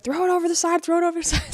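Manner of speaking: mock urgent command. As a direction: shout it up high, hurry.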